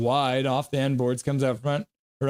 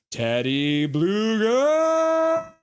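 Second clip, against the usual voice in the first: higher, very slow, stretched cheer; mock drawn-out cheer; 8 semitones higher, much slower than usual. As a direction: sing it out long like a chant. Acting out a chat cheer.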